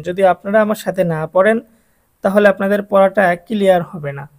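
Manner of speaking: ordinary delivery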